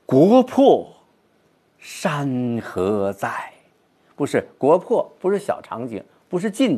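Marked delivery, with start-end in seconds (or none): 0.0-3.5 宏大地朗诵国破山河在; grand recitation. Shown as a demonstration. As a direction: open wide and sweeping on 国破, draw out 山河在. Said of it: "我们应该宏大一些".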